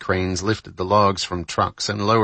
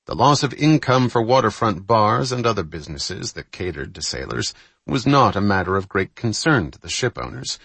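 plain reading voice

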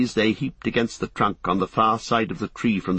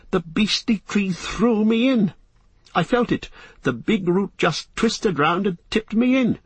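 high-pitched, startled hobbit; Frodo, indignant; 10 semitones higher than usual. Acted. Frodo to Sam after nearly drowning, high and indignant. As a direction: pitch it well up, freshly woken and put out.

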